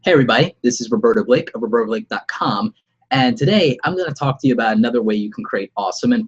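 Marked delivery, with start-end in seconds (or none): none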